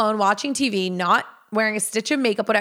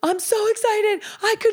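excited breakdown; 12 semitones higher than usual. Retold or imitated. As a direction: squeal it high, on the verge of happy tears. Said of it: "I'm, like, having a breakdown".

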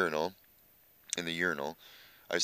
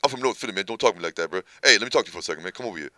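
lightly voiced streetwise Philly guy, a touch louder; imitating Reg's way of talking; 3 dB louder, much faster than usual. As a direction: do a hint of the tough North Philly friend bragging. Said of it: "That's how he talks".

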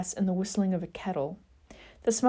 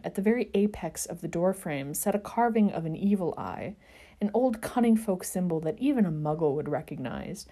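usual speaking voice

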